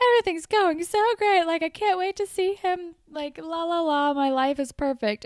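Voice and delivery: put-on high, fast, bubbly girly gush; sing-song it like nothing's wrong; giddy. Paige quoted, giddy.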